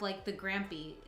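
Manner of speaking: usual speaking voice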